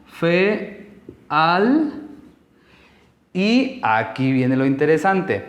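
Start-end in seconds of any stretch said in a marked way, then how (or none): 0.1-2.0 FE and AL as separate drawn-out syllables; "fe... al", slowly divided for a learner. Shown as a demonstration. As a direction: say "fe", pause, then "al", each held. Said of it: "y se viene como".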